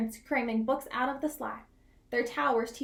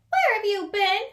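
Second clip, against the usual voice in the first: higher, louder animal friend asking; Porcupine voice; 8 semitones higher, 8 dB louder than usual. Acted. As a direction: call out the question in a raised voice. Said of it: "asked Porcupine".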